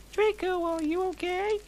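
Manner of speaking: very high squeaky character voice; a mocking falsetto asking if he's okay; high falsetto question. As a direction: squeak it up like a cartoon girl.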